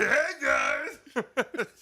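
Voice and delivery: high, loud comic squawk; Bobcat Goldthwait. Acted. Host repeating an impression on request, high loud squawk. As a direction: yelp it in a strangled, high comedian voice. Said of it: "Were you doing Bobcat Goldthwait? Do it again".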